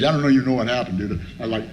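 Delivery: normal talking voice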